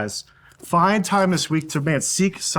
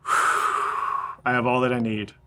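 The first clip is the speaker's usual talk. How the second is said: slow, soft prayer on a long out-breath; sighed out on the exhale; 3 semitones lower, 4 dB quieter, slower than usual. Shown as a demonstration. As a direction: let the line ride the exhale like a sigh. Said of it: "you inhale".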